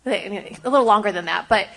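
ordinary delivery

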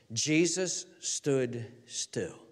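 slow, low, softened phrase; quiet, weighty scripture quote; hushed reading. Real, not acted. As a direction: draw it out softly, word by word. Hushed repeat of key verse.

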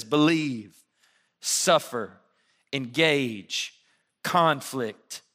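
each word set apart with a pause and falling; key words spaced out. Shown as a demonstration. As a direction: weigh every key word, one by one. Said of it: "Key words".

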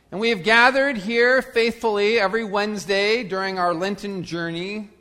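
ordinary talk

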